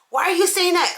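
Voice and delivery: high, baffled demand; why would you say that; incredulous. Retold or imitated. Confronting the friends.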